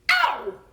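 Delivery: sharp, high, loud yelp; cry of pain. Acted. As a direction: yelp as Rabbit pulls. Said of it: "cried Pooh".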